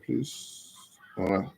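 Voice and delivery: ordinary talk, nothing marked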